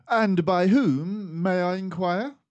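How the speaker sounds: a composed mouse detective taking charge; Basil the detective. Acted. Basil questions messenger.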